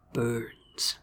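low seductive murmured name. Acted. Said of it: "seductive Burns voice murmured".

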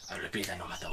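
soft, hushed attempt at a Spanish accent; Pep Guardiola. Podcaster quotes a football manager to his co-host, hushed Spanish accent. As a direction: murmur it in a put-on Spanish accent. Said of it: "but he's always like".

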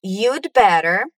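slowly, chunk by chunk; slow drill. Shown as a demonstration. Teacher drills students, slow chunks. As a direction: model it slowly for repeating. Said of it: "Repeat again".

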